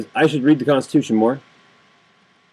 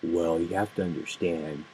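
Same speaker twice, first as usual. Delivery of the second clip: lower, softer, slow condescending mock voice; mocking liberal; 7 semitones lower, 13 dB quieter, slower than usual. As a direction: mimic the patronizing liberal. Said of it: "And they go".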